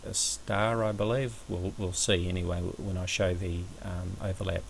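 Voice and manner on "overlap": ordinary delivery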